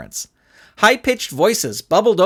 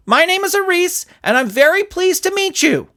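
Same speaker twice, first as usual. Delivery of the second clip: put-on small boy, pitched high and polite; a little kid offering his hand; earnest child voice; 14 semitones higher than usual. Child Aris speaks, high voice.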